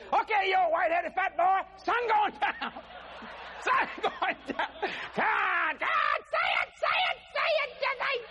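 high shouted playful demand; hammed-up wife with a laugh; wife demanding husband apologize, mock shouting; teasing wife.

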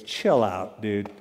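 low, laid-back surfer drawl; cool and unbothered. Retold or imitated. The calm prophet tells his scared servant, low and laid-back. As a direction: shrug off the danger, easy and low. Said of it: "he's just so, so cool".

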